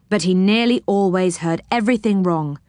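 plain reading voice

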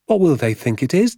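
put-on high child's question; lightly acted wondering child; curious boy. A narrator's reading. Young boy questions his father.

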